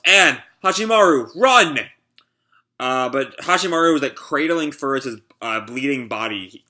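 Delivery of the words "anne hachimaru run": higher, louder warning yell. Acted. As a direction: shout at them to run. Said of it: "the samurai yells".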